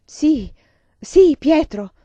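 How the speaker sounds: loud, high furious confirmation; raging shout; roaring. A narrator's reading. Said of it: "ruggì Vasili".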